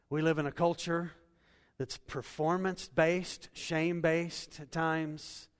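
rising preacher's cadence, pressing each line; building sermon. Acted. Pastor listing how culture judges us, building sermon voice. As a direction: stack the list like a preacher warming up.

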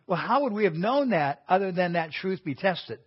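ordinary talk, nothing marked

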